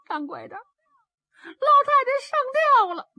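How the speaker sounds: high-pitched, broken wail of a sobbing maid; tearful wail. Acted. Maid tells master his wife hanged herself, tearful wail. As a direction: choke out the bad news in a high wail. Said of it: "都哭得背过气去了".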